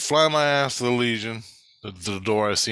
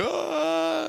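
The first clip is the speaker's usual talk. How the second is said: a high, sudden yelp of pain, much higher; mock pained scream. Man shot in the foot, yelping as he flees: high mock scream. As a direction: squeal it out like you just got shot in the foot.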